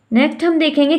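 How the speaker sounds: ordinary delivery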